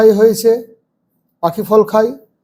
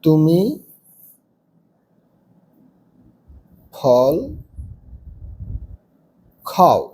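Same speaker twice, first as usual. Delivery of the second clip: single words with long pauses, pitch sinking lower; slow dictation; 4 semitones lower, 4 dB quieter, much slower than usual. Shown as a demonstration. Teacher dictating to pupils, slow word by word. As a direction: dictate each word separately. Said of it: "চার নম্বরে তুমি".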